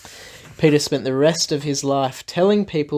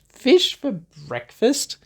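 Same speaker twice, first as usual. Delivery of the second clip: high, slow incredulous question; puzzled, can't quite believe it; surprised; 9 semitones higher, slower than usual. Acted. Teller wonders aloud to children, high and incredulous. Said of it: "That's weird".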